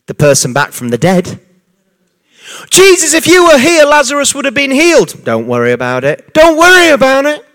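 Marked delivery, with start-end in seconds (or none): 2.7-5.2 a grieving sister begging, voice pitched up; pleading reproach. Retold or imitated. Martha reproaching Jesus over Lazarus. 5.2-6.3 low, unhurried, soothing; calm reassurance. Retold or imitated. Jesus reassuring the grieving sisters, low calm soothing. 6.3-7.4 high, louder, incredulous parroting; mocking echo. Grieving sister repeating Jesus' words, high incredulous mocking.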